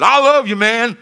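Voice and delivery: loud, high mock holler; imitated exuberant shout. Man with arm round the sheriff, imitated exuberant holler. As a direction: holler it like an over-affectionate buddy.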